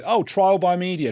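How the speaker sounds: high, mocking sing-song on the slogan. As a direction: parrot the slogan with a sneer. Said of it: "a slogan like".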